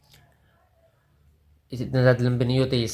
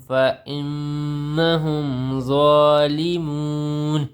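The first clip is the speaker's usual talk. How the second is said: slow, melodic chanted verse; Quran recitation; 3 semitones higher, much slower than usual. Acted. Teacher repeating the verse for students in slow melodic tilawat. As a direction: intone the whole verse long and sung.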